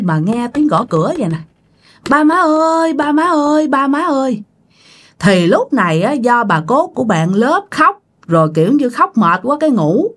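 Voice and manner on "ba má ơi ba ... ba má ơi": high, repeated call for mum and dad; high insistent calling; daughter calling out. Acted. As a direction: keep calling to parents behind a door. Daughter calls parents from outside at night.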